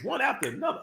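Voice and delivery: usual speaking voice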